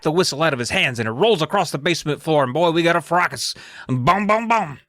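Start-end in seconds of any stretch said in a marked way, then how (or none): 3.9-4.8 high, punched "boom" three times; mock dramatic sting. Narrator capping the fracas, mock dramatic sting. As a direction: voice a suspense sting in three beats.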